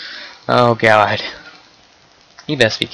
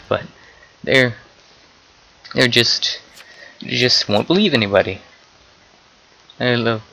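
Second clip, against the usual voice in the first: ordinary talk, nothing marked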